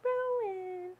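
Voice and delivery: high sing-song name call; calling a cat. Real, not acted.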